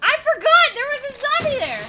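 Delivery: high, loud cry after a scare; shaken wail. Real, not acted. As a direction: wail in leftover fright. Recovering from zombie scare.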